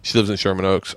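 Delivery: ordinary talk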